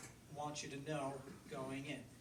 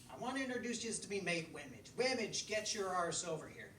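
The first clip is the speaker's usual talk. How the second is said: higher and louder, hailing a mate; cheeky fox; 4 semitones higher, 4 dB louder than usual. Acted. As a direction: call the mate over like a rowdy fox. Fox introduces friend to lads.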